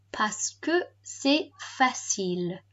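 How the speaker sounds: slow, word-by-word French. Shown as a demonstration. Teacher repeating the recorded reason for pupils, slowly. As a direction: space out each word for learners. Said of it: "And slower".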